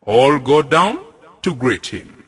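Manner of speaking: ordinary delivery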